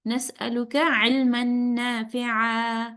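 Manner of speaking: slow, drawn-out Arabic prayer recitation. Shown as a demonstration. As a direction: recite it slowly, letting each word ring. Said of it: "The correct way of doing it".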